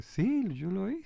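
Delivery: high, drawn-out protest of honesty; mock insistence. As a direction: lightly act out an exaggerated claim of authorship. Acts out insisting on his lie. (insistencia fingida, aguda y alargada)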